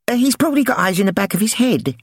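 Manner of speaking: put-on high, fast boyish chatter; boy Milo. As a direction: rattle it off like a kid. Milo speaks.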